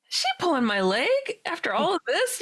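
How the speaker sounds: high-pitched retold suspicion; incredulous. Teller wondering whether friend is pranking her, incredulous. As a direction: pitch up in mock disbelief.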